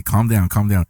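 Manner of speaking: normal talking voice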